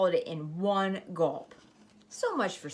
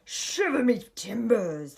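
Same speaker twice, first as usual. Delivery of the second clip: slow, hammed-up pirate growl; pirate captain; 3 semitones higher, 3 dB louder, slower than usual. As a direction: play the bluff pirate captain. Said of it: "the pirate said".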